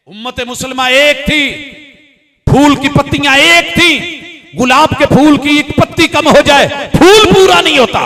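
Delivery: high, loud oratorical declamation; full-force preaching. Real, not acted. Preacher laments to congregation the lost unity of ummah, loud declamation. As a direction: proclaim it to the crowd, building to the end.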